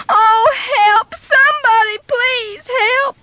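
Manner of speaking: put-on a frightened child calling with a sob; crying for help. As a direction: beg anyone to hear you. Trapped boy calls out crying.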